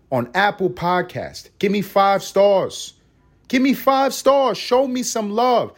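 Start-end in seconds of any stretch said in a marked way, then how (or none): 1.6-2.9 slow pitch. Real, not acted. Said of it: "plea for ratings, repeated". 3.5-5.7 higher, slow, pleading; drawn-out plea. Real, not acted. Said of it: "repeated higher and slower".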